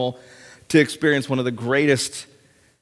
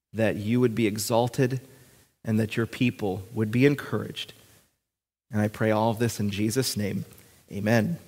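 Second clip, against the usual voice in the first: low, soft, measured prayer; 4 semitones lower, 5 dB quieter than usual. Real, not acted. Pastor praying before examining the text, hushed and reverent. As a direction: pray quietly with pauses between phrases.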